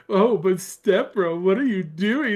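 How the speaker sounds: hammed-up coy stepsister; coy and happy. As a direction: play it sugary and coy as the stepsister. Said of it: "played all, like, coy and happy".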